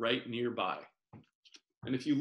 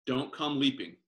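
plain reading voice

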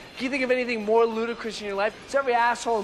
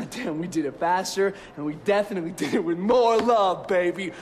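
ordinary talk, nothing marked

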